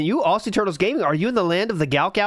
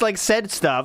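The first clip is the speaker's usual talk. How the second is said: ordinary talk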